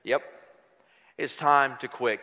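low, falling reply; answering. Retold or imitated. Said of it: "And he would say".

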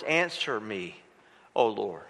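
low, soft calm prayer; quiet, humble plea. Retold or imitated. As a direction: speak gently to the Father. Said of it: "He calmly talks to his Father".

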